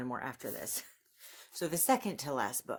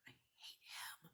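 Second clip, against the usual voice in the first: a breathy whispered aside, dropped almost to nothing; 21 dB quieter than usual. Real, not acted. Booktuber slips a private grudge into her summary, whispered. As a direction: mutter it under your breath like a secret confession.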